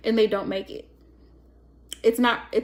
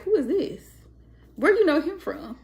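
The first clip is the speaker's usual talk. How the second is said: higher suspicious questions, who is this; jealous boyfriend questioning; 5 semitones higher than usual. Retold or imitated. Voicing a jealous man questioning his girlfriend. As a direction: play the boyfriend grilling her.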